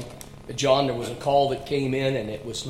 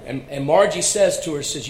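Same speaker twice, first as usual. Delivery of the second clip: ordinary talk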